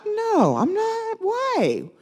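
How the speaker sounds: high-pitched mock indignant refusal; defiant refusal. Retold defiant inner refusal.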